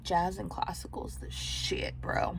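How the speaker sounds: slow hushed confession; whispering. Retold or imitated. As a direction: whisper it slowly like a secret. Inner thought, relaxed jazz lover.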